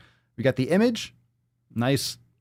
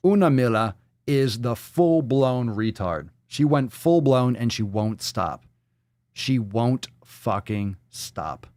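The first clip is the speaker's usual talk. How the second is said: slow, heavily spaced declaration; emphatic verdict; slower than usual. Real, not acted. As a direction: land each word separately. Mock-grave verdict on poster.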